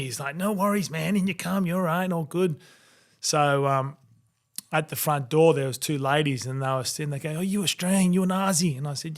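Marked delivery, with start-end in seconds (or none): 0.4-2.6 higher, breezy imitation of a doorman; easygoing mock welcome, pitched up; reassuring bouncer. Voices bouncer waving him in. 7.4-8.8 higher-pitched imitation of two curious ladies; mock-curious, lifted voice; ladies' eager questioning.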